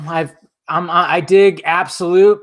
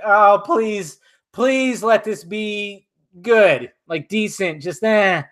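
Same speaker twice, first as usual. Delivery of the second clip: retold hopeful plea; 6 semitones higher, much slower than usual. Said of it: "I was hoping".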